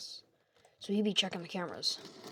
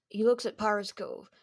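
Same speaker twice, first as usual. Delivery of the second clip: ordinary delivery, nothing marked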